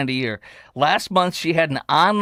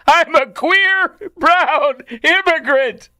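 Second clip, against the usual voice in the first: high, loud, slow mock proclamation; hammed-up declaration at full pitch; mocking quote; 13 semitones higher, 6 dB louder, much slower than usual. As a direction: blare it out as an absurd announcement. Mocked quote, bigger each time.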